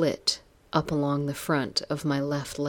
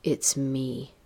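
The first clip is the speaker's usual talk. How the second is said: slightly lowered voice of the man in the hall; deep male voice; 3 dB quieter than usual. A narrator's reading. Visitor answering the wife from the hallway, deep voice. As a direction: answer briefly in a lightly deepened voice. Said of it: "The voice was deep, unfamiliar".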